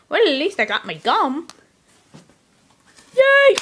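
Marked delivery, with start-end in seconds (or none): 3.2-3.6 much higher, loud cheer; joyful cheer. Acted. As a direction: shout for joy.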